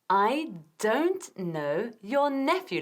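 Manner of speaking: slow, careful phrase; slow model. Shown as a demonstration. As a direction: model it slowly.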